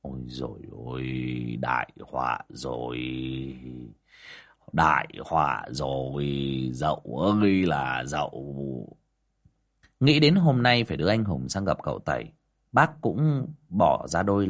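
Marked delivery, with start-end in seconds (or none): 0.0-9.0 low, soft, stunned mumble; dazed muttering. A narrator's reading. Neighbour stunned that the woman has gone missing, low dazed muttering. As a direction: mutter numbly to yourself, drawing the words out. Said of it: "đờ đẫn cả người mà lẩm bẩm".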